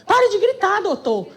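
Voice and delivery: high-pitched mock yell. Friend joining in against judge, high mock shout. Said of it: "E a [name] grita também".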